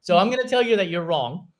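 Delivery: high laughing tease. Real, not acted. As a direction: laugh through the words. Laughing while teasing guest.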